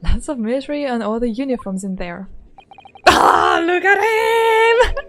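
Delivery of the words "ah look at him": high, slow gushing squeal; fangirling over a game character, high and slow; excited squeal. Real, not acted. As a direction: swoon over him out loud. Seeing game boyfriend.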